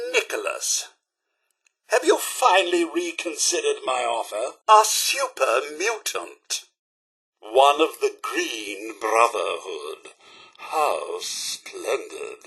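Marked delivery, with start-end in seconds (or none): 4.7-12.4 slow, drawn-out disdain, sinking low; dry disdain. Acted. Butler greeting a super mutant, with slow, dry disdain. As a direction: let the politeness drop slowly into dry irony.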